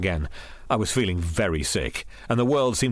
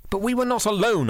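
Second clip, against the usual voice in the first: high-pitched, urgent objection; pleading objection, pitched far up; protesting; 13 semitones higher than usual. A narrator's reading. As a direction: protest your innocence. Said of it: "I protested".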